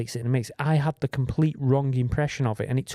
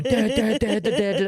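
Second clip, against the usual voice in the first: imitated piano; 6 semitones higher than usual.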